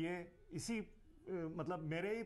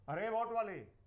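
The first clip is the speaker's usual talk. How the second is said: higher, mock-dramatic call; recited acting line; 5 semitones higher, 4 dB louder than usual. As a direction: call out like a child delivering a stage line. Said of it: "ऐसे कोई dialogue था".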